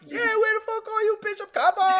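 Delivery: high, loud cheering at the screen; excited kid yelling. Retold or imitated. Kid rooting at the movie screen, high excited yell. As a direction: cheer the hero on like a kid in the theater. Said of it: "And I'm like".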